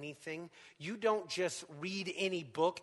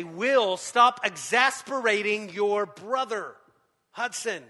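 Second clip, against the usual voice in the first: louder retold scolding call; parent scolding; 4 semitones higher, 10 dB louder, slower than usual. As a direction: call out to the kids from the front seat. Parent scolding sons in car.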